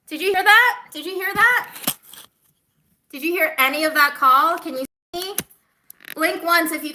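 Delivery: loud, high, calling out across a bad line; loud check. Real, not acted. Friends on the call, loudly checking the line. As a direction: make sure they hear you.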